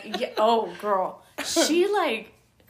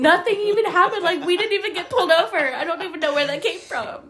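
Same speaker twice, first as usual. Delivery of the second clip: higher, fast mock protest; hurried, baffled denial; a higher, rushed imitation of mom; flustered mom; 8 semitones higher, 4 dB louder than usual. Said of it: "she was like".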